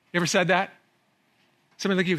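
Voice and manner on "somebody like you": usual speaking voice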